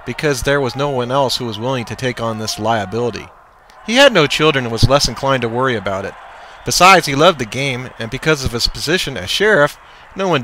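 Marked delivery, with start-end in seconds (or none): none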